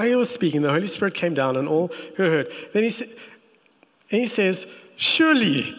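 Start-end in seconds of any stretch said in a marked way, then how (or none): 5.0-5.7 high, astonished surely; shocked. Retold or imitated. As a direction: gasp it out in disbelief. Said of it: "he's almost shocked. You can read this in this, in his tone".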